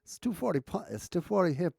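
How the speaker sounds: put-on faltering stab at an Australian accent; failed Aussie accent. Streamer fumbles the Aussie accent, failed Australian attempt. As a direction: lose the Aussie accent halfway through. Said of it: "I lost my Aussie accent".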